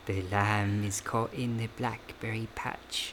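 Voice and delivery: slow, soft vampire-woman impression; Drusilla. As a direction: draw it out softly, imitating the vampire's accent. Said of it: "Love Drusilla's accent".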